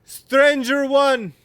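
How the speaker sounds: slow, high, loud giant call; yelling giant. Acted. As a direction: bellow it down the cave. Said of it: "yells down into the cave".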